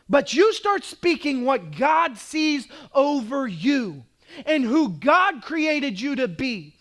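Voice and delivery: high, loud declaring sermon voice; preaching at full force. Acted. Pastor declaring identity over the congregation, full-force preaching. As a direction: proclaim it to the congregation.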